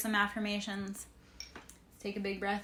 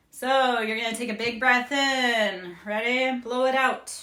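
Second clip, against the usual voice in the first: loud coaching; 3 semitones higher, 10 dB louder than usual. Acted. Coaching a breath, energized.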